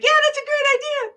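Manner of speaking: squeaky high imitation of a friend; high mock voice. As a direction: pitch way up, chirpy. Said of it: "Malena's like".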